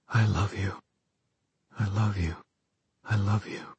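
quiet, almost breathless I love you; soft and aching, a hint of despair; soft tender whisper. A narrator's reading. Silent repeated declaration.

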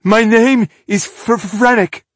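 high, slow, trembling stutter; frightened stammer. Acted. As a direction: force the name out in fear. Prisoner repeats his name in fear.

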